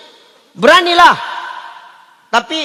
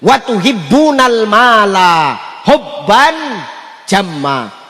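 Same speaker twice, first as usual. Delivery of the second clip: slow recitation; much slower than usual. Acted. Quran recitation in sermon.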